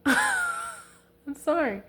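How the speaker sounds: giggling through a drawn-out, high sorry; laughing apology. Real, not acted. Reader laughing at spilled cards, laughing through sorry.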